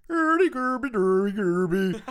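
high, loud mock Swedish gibberish; Swedish chef voice. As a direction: babble like the Muppet chef. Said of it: "the Swedish chef".

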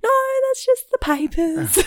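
dismissive guest; high, dismissive put-on voice. Guest voice in retold chat.

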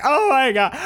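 high-pitched mock whine; mock distress. Host imitating the irritating skis girl, high mock distress. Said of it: "and she's like".

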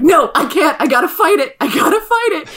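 hammed-up struggle against temptation; desperate self-restraint. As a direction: build to a strained, comic protest. Acts out resisting his flirting.

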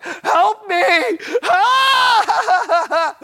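high, loud, helpless laughing shout; laughing cry for help. Retold or imitated. Man on the church floor, laughing helplessly, shouting high. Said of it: "I'm like".

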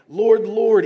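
1 loud pleading cry. Acted. Crowd pleading at judgment.

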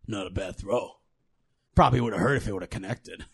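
low, fast, amused monster voice; deep creature teasing a missed punch; a low chuckling brute, quick and dry; Minotaur's voice. Acted. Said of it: "looks at the gnome and goes".

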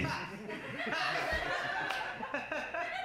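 long breathless laughing fit; real laugh. Real, not acted. Comic cracking up at his own joke, a long breathless laugh.

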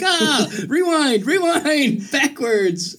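high, stretched-out cry to undo it all; laughing, hammed-up alarm; mock panic. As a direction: call a frantic halt, playfully. Retold panic, wanting to undo it.

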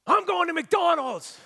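high, loud angry shout, imitated; yelling. Angry customer at the drive-through window, yelling. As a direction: yell it like an irate customer, drawing out the end. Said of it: "And he yelled at me".

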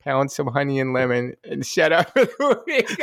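put-on New England accent breaking into laughter; mimicked New England accent. Listener repeating the church lady's line, laughing New England accent. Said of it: "With the accent".

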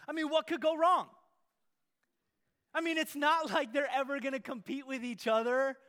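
loud, high mock enthusiasm; big sarcastic preacher's build-up. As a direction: oversell it so they hear the sarcasm. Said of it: "I wish I didn't have to say that with such sarcasm".

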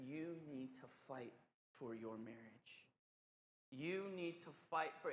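soft, low and tender urging; loving counsel. Retold or imitated. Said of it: "And he just so lovingly says".

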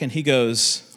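usual speaking voice

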